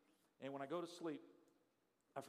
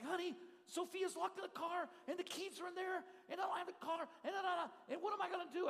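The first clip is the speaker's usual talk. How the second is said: high, rushed panicky pleading; panicked wife; 9 semitones higher than usual. Retold or imitated. Wife on the phone to husband, panicked about the locked car. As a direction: blurt it all out in a rush of fear.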